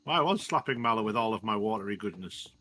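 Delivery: ordinary talk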